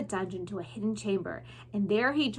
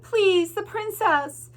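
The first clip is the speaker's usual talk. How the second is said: high, loud, desperate mouse; pleading mouse voice; 9 semitones higher, 6 dB louder, slower than usual. Acted. Mouse Despereaux begging rats in dungeon, high pleading. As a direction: beg the rats for the princess.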